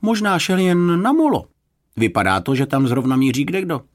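high, lively encouragement; encouraging. A narrator's reading. Lifeguard cheering up the searchers, encouraging. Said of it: "pokusil se je povzbudit záchranář".

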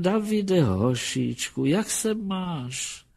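very high, creaky elder's voice; creaky old grandparent. A narrator's reading. Grandparents marvel at how their grandson has grown, creaky old voices. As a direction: fuss over the grandson in a thin, high old voice. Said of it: "zaskřípali hlasy".

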